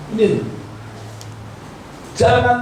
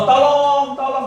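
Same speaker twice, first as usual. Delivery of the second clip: raised, higher-pitched call for help; mock cry for help; 7 semitones higher, slower than usual. Trapped person asking others for help, mock calling out.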